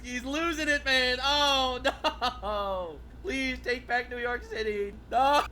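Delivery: high and loud through laughter; laughing. Real, not acted. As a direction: cackle at his meltdown. Streamer laughing.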